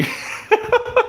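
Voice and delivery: breathless high giggle; real burst of laughter at the clip. Real, not acted. Watching the face-off clip, high breathless giggle.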